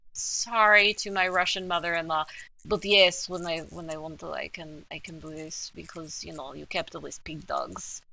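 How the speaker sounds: low, quiet Russian accent; mock Soviet sneer in a thick Russian accent. Shown as a demonstration. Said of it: "I'm very Russian".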